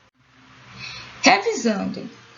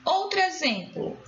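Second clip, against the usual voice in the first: normal talking voice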